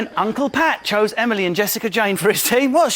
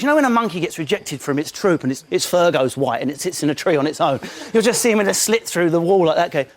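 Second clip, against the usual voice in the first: ordinary talk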